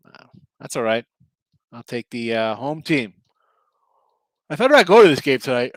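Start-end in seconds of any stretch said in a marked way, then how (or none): 4.5-5.7 talking through a yawn; stifle a yawn mid-sentence. Real, not acted. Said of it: "excuse me for yawning".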